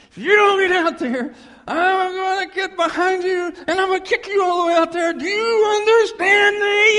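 loud, high coach bellow; imitating a yelling coach. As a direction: roar it like a furious football coach. Coach yelling at boys' team.

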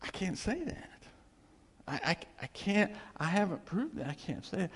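halting, high-pitched stammer; hesitant imitation of someone unable to answer; acted reluctant protest. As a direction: stumble over the words, voice up. Peter struggling to answer Jesus.

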